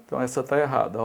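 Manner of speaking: usual speaking voice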